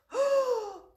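loud, high gulp of air; demonstrated puff. Shown as a demonstration. Teacher showing children how to puff, big loud breath. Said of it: "and puff".

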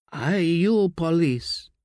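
slow, high and thin, a dying man's rasp of a question; barely holding the words together; brittle, thin voice. Acted. Dying uncle asks the stranger if he is police, brittle and thin. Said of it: "his voice brittle and thin".

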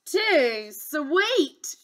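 fussy, high princess complaint; loud picky exclamation; crying out, disgusted. Acted. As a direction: reject each one like a spoilt child. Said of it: "she cried".

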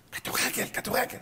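soft, hoarse rasp of a fan; croaky voiceless imitation; lost voice. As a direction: squeeze the word out with no voice left. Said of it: "נכנס לך בתוך הגרון".

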